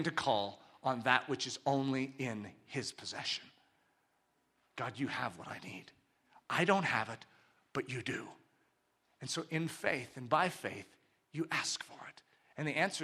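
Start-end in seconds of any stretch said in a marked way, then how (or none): 4.8-8.3 hushed, low plea to God; quiet, surrendered confession of need; humble prayer. Acted. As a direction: pray softly and simply.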